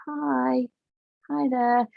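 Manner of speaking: high, tight fake-friendly hello; fake polite greeting. Shown as a demonstration. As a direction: greet someone you can't stand. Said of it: "smiling at someone that you don't like".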